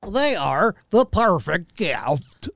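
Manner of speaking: slow, exaggerated cheesy ad voice; mocking own ad read. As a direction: parody your own clumsy read. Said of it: "mocking my own voice".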